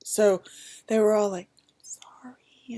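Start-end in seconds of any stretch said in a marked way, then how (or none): 1.8-2.8 much softer unvoiced whisper; soft sympathy. Staff whisper sympathy to the disappointed mother. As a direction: breathe a sorry.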